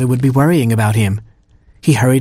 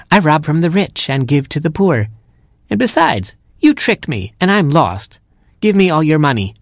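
higher, eager little bird voice; lightly raised superhero bird; young robin hero; 6 semitones higher than usual. Acted. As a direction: play the plucky robin hero.

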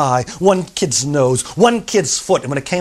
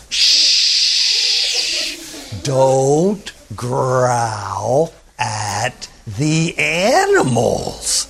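very slow, hushed scold; gentle scolding; 4 semitones lower, much slower than usual. Acted. Teacher gently scolding a noisy girl, slow and hushed. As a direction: hush her gently, drawing it out.

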